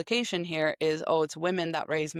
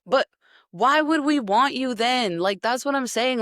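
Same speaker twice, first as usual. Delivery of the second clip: higher and louder, fed up; exasperated; 7 semitones higher, 5 dB louder than usual. Real, not acted. Vlogger firing back at men's comment, exasperated and louder. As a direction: throw the question back, incredulous.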